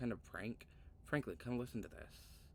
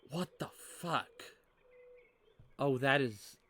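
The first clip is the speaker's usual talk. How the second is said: startled outburst; 4 semitones higher, 6 dB louder, slower than usual. Real, not acted. Startled by creepy game visuals.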